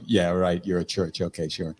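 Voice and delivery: sarcastic. Retold or imitated. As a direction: brush it off with mock agreement. Mocking the church's claim.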